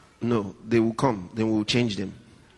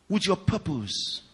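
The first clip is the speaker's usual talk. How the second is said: normal talking voice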